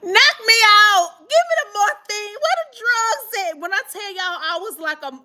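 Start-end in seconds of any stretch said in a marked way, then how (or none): 0.0-3.6 high, wailed mock plea for drugs; begging like a crackhead. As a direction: imitate yourself pleading desperately, high-pitched. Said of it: "I was like a crackhead".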